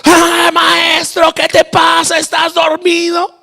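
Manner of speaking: high, drawn-out shout; hammed-up, high-pitched indignant yell; shouting at Jesus. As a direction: yell to wake a sleeping teacher. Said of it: "¿Cómo gritándole a Jesús?".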